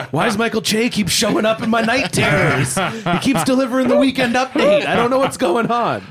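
high-pitched, hammed-up bewildered complaint; mock distress. Acting the night-terror sufferer complaining.

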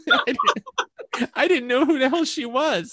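high, laughing admission. Real, not acted. Storyteller admitting they didn't know the VIP, laughing. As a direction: say it through real laughter.

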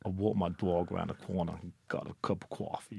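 low, soft attempt at Brooklyn; clumsy Brooklyn accent. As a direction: have a half-hearted go at a Brooklyn accent. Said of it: "about as good as it's gonna get".

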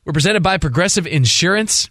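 upbeat show intro, smile in the voice; smiling radio intro. Real, not acted. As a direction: announce the show with a grin. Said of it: "If you hear a smile in my voice".

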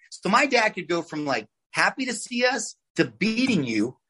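ordinary talk, nothing marked

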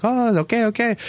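high-pitched imitation of dawning understanding; obliging stranger catching on. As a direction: imitate a helper who finally gets it. Voicing stranger finally understanding.

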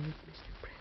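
barely voiced, breath only; fading words, just air; faint whisper. Dying wife whispers to husband.

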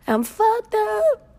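high, slow drawn-out whine, hamming it up; mock whine. Host mocking herself to listeners, high drawn-out whine. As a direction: stretch it way up high like a mock cry.

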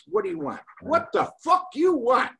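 high-pitched, exasperated mock yell; retold screaming demand. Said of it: "after his screaming and everything".

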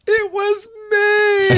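high, slow, crying shout; mock tearful wail of guilt; wailing confession. Friend confesses to the vomit.

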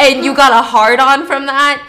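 loud disbelieving question; incredulous outrage. Real, not acted. Host scolding the character for his arousal, incredulous. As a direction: throw the accusation out loudly.

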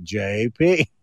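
low, deliberate "J P"; mock spelling out. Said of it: "How do you spell that".

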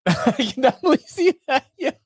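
high, fast words through real laughter; laughing. Real, not acted. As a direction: laugh hard while talking. Amused at phone voice remark.

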